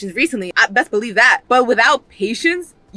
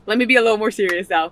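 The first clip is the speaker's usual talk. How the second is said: usual speaking voice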